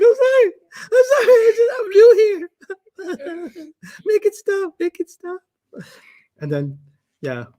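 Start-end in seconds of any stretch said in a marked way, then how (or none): 0.0-3.6 much higher, whimpering mock apology; hammed-up scared teenager pleading, near tears; mock tearful whimper, much higher, slowing down at the end; imitated frightened apology. Frightened young agent apologising. 4.1-5.4 mock plea. Pleading, retold panic.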